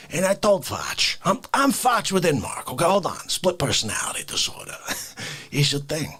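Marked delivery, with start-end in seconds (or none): none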